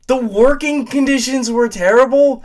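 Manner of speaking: high, loud, outraged echo; outraged shout shooting up in pitch; incredulous, voice cracking. Real, not acted. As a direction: throw it back in cracking disbelief. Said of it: "I'm literally voice cracking".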